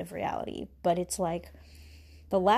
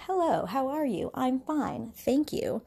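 put-on higher, sing-song polite child; kid reciting manners; 6 semitones higher, slower than usual. Child greeting an adult politely, higher sing-song recital. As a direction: recite it like a little kid's script. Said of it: "you do it as a little kid too".

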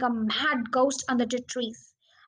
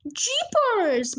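amazed; 9 semitones higher than usual. A narrator's reading. Father amazed by falling birds.

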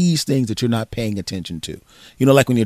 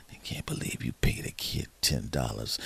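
whispered, much lower and softer grumble; mock sulky whisper; 12 semitones lower, 15 dB quieter than usual. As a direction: grumble under your breath at dinner. Son muttering at dinner table.

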